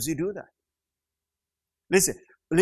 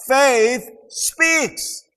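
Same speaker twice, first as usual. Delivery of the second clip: loud emphatic declaration of faith; preached proclamation; 8 semitones higher, 5 dB louder than usual. Real, not acted. Preacher declaring to church, loud proclamation. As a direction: proclaim it to the congregation.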